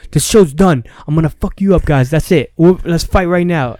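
angry threat. Retold or imitated. Meathead shutting down the show to fight, angry yell. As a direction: end the show, ready to brawl.

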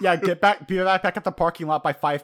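loud, high tour-guide announcement; mock tour guide. Joking to a co-host, a loud mock tour-guide callout. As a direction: call out to the stragglers like a bossy tour guide. Joke riffing on the scene.

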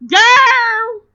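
high loud exclamation. Real, not acted. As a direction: throw the word out with full sass. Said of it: "trying to do what you did".

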